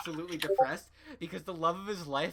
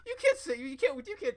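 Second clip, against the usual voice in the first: much higher at the start, laughing through it; 10 semitones higher, 6 dB louder than usual. Real, not acted. Echoing Steven's whiny plea to Connie, laughing. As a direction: choke out the words while laughing.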